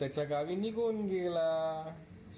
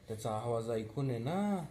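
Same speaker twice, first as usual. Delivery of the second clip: ordinary delivery, nothing marked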